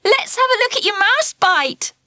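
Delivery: high, sing-song teasing call; teasing girls. Acted. Girls to Ralph in the playground, calling out teasingly. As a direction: call out to mock him. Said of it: "the girls called to him".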